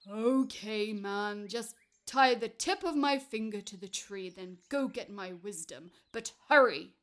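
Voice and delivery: loud, booming giant's consent; impatient giant. Acted. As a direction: agree loudly and urge him to hurry.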